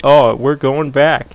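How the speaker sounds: ordinary talk, nothing marked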